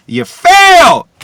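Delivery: very high, very loud, slow scream; scream of contempt. Real, not acted. Telling John Skipper he failed, screamed at full force. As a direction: scream the verdict at him.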